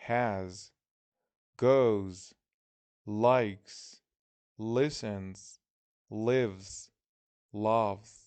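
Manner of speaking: each verb said alone, slowly, with a clear final s; clear word-list reading. Shown as a demonstration. Teacher reads the word list aloud to the class, slow and clear. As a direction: read the words one by one, landing every ending.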